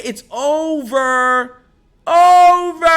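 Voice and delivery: slow, stretched "over", then a loud high "Over"; drawn-out gloating. Real, not acted. Gloating at rival fans, slow then loud. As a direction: savor it, then blast the last word.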